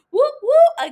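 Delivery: high, loud whoop whoop like a cheering fan; a real burst of excited cheering; excited woo. Real, not acted. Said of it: "Again, why am I wooing?".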